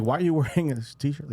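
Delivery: high, quick teasing question; playful mock-shocked jab; mock incredulous. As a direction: rib him in disbelief.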